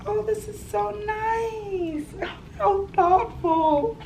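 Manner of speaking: high, slow, softened gushing; touched delight. Real, not acted. Vlogger admiring roommate's gift, touched and gushing. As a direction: coo it out, high and stretched, with a little laugh.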